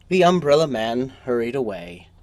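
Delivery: usual speaking voice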